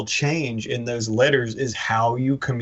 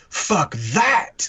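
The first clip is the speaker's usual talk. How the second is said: higher and punchy, rising on that; big lettering; 6 semitones higher than usual. Shown as a demonstration. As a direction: blast it like giant comic lettering. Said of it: "that all big and".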